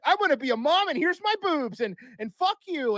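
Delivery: high, loud mock rant. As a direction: mimic a star going off the rails. Mimicking [name]'s defiant rant.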